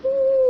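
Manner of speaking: very high loud howl; high fox howl for kids. Shown as a demonstration. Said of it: "What is the animal fox sound?".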